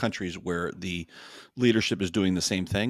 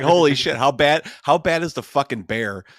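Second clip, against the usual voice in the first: loud, high disbelieving exclamation; retelling his reaction, loud and high; amazed outburst; 5 semitones higher, 8 dB louder than usual. Retold or imitated. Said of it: "I was like".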